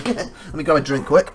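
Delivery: ordinary talk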